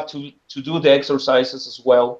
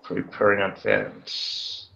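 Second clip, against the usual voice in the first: muttering to self; 6 semitones lower, 7 dB quieter, slower than usual. Real, not acted. Repeating tip while taking notes.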